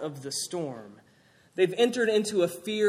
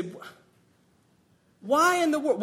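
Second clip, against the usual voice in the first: high, loud disbelieving outburst; incredulous why; 9 semitones higher, 4 dB louder than usual. Retold or imitated. Preacher voicing the church's shock at Jonah, incredulous. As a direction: cry it out in disbelief.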